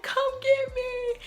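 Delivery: very high, drawn-out whiny plea; mock near tears. Scared rider begging a parent to come, mock wailing. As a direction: wail it slowly, hamming up the tears. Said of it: "I was literally about to cry".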